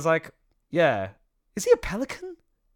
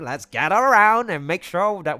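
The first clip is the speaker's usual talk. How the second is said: loud, higher, hammed-up organizer; cartoonish leader addressing the group, building louder; Sano character voice; 4 semitones higher, 9 dB louder than usual. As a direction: round everyone up in a big put-on voice. Acting Sano gathering the group.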